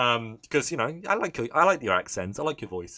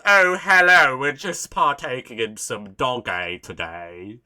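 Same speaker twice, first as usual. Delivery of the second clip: loud hammed-up British greeting, easing off; caricatured British, slowing as it goes; mock British accent; 8 dB louder, slower than usual. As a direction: put on an exaggerated polite British accent. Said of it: "what British person goes".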